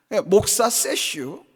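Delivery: slow, drawn-out Chungcheong 세슈 in a changed voice; Chungcheong dialect. Retold or imitated. Preacher mocking the elder's remark, slow Chungcheong drawl. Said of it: "충청도 말은 짧거든요".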